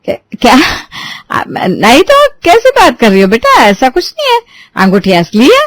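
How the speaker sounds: high, caught-out stammer; nervous flustered denial. A narrator's reading. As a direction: scramble to deny it. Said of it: "Indu एकदम से सकपका जाती है".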